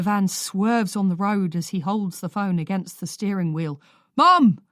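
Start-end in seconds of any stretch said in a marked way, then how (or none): 4.2-4.6 loud call across the van; shouting. Acted. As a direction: shout it out to mum. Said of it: "Ollie shouts".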